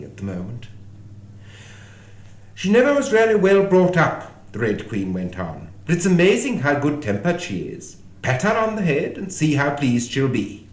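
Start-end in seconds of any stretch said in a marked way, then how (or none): 2.5-4.5 high, loud, brisk pronouncement; higher and much louder than narration; Red Queen. A narrator's reading. 5.9-10.7 high, loud, emphatic character voice; Red Queen. A narrator's reading.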